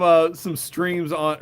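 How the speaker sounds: ordinary talk, nothing marked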